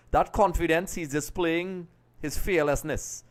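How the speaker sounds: ordinary delivery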